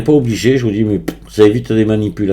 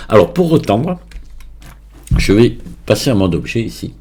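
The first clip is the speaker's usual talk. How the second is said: ordinary talk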